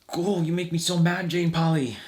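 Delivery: high, sulky sore-loser whine; pouty impression of a beaten man; George Lucas impression. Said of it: "That was Will Sasso doing George Lucas".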